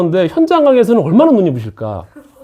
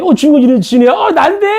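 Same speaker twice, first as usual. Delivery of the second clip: high-pitched, louder mock astonishment; acted surprise; 10 semitones higher, 4 dB louder than usual. Teacher re-enacting hearing his own name called, surprised. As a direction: jump up in pitch as if startled.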